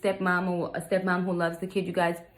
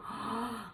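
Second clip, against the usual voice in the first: acted gasp of disbelief; shocked gasp; 3 semitones higher, 11 dB quieter than usual. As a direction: gasp as if appalled. Acts out her shocked reaction.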